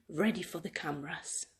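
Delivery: whispered suspense. A narrator's reading. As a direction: breathe it before the scare. Sneaky build-up.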